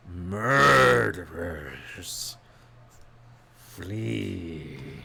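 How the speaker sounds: drawn out, disdainful dragon rumble; slow dragon voice. Acted. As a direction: savor each word like a bored beast. Dragon repeats, contemptuous.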